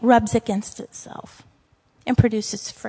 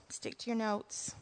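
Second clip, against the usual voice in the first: fading almost to nothing, much softer; barely audible mutter; 4 semitones lower, 20 dB quieter than usual. Real, not acted. Preacher repeating her self-reminder, nearly inaudible. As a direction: repeat it to yourself almost silently.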